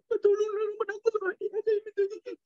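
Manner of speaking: put-on high, small child's plea; little child voice. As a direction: beg like a youngest kid. (mataas na boses ng batang bunso)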